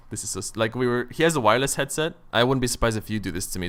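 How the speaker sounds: ordinary talk, nothing marked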